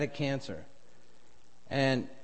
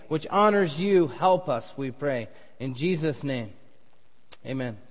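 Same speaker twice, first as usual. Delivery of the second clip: earnest pulpit prayer, swelling louder mid-way; 4 dB louder than usual. Acted. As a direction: pray it over the congregation. Pastor leads opening prayer.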